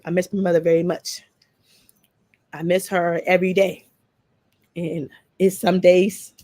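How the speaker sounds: real grief pressing on each word; emotional. Real, not acted. Speaking to the chat about missing her late mother, grieving. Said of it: "I got a little emotional".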